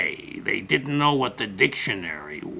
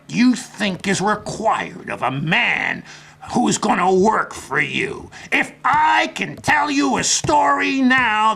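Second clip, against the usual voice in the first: higher and louder, pushing the pitch to a close; hard sell; 9 semitones higher, 4 dB louder than usual. Retold or imitated. Pep pitching to the interviewer, loud hard sell. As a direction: sell it hard, driving toward the signature. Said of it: "I was selling hard then".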